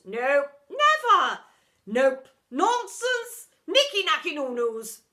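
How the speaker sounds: high, loud, drawn-out protest; Miss Epoch protesting. A narrator's reading. Outraged teacher refusing the detention, loud and high. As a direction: bellow every refusal like an outraged old teacher.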